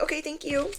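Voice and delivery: high, soft, rising reply; small touched voice. Retold or imitated. Thanking the coffee server, small touched voice.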